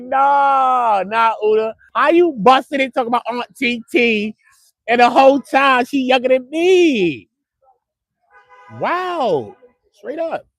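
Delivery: high-pitched, drawn-out incredulous protest; incredulous retort. Retold or imitated. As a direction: act out disbelief, voice up high, dragging the last words. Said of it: "I'm like".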